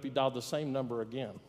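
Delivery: ordinary delivery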